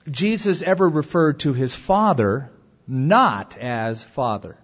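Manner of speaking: ordinary talk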